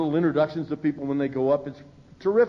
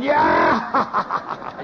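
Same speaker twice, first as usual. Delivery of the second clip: higher laughing shout; crowd's laughing cheer; 9 semitones higher, 3 dB louder than usual. Retold or imitated. Old-timers cheering the drunk story, laughing whoop. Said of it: "we're all going".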